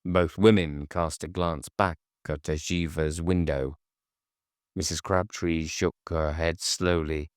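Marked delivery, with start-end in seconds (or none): none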